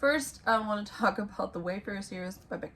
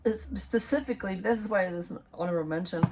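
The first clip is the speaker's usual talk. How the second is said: normal talking voice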